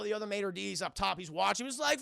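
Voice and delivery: ordinary talk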